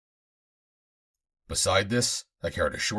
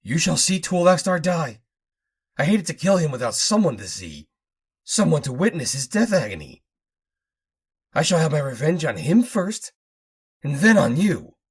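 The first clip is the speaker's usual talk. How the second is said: put-on high, louder mad old man; vengeful raving; 9 semitones higher, 6 dB louder than usual. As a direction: rave about revenge. Fortock vows revenge.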